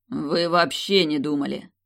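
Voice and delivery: slow, sharp jab on "вообще"; cutting retort. Acted. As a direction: cut him off, slowly stressing "вообще". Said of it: "оборвал его император". (резко, с нажимом на "вообще")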